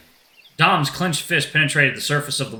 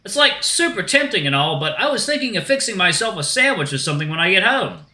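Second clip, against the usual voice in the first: high, breezy dude voice; joking shark dude; 7 semitones higher, 3 dB louder than usual. Acted. Shark jokes with the group about lunch, high dude voice. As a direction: brush it off like a surfer dude.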